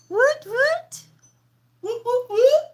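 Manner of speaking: high, loud celebratory woots; cheering. Real, not acted. Streamer cheering loudly for a two-dollar donation. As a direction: whoop it up for the donor.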